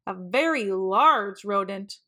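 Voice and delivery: ordinary delivery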